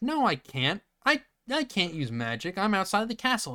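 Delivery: mock whiny denial; whiny protest. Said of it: "And he's like".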